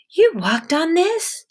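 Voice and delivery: astonished at the swollen foot; shocked woman voice. Acted.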